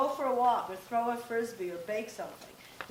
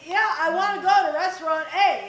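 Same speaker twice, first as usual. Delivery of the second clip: louder, higher, half-acted annoyance; mock mad insistence; 6 semitones higher, 13 dB louder than usual. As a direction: put your foot down, playfully irritated. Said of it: "Now I'm getting mad".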